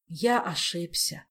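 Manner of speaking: slow, sighing admission; heavy sigh. Acted. Stranger confessing his mistake, slow and weighed down. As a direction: admit it heavily and slowly. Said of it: "тяжело вздохнул незнакомый мистралиец".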